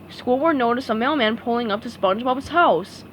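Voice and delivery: ordinary delivery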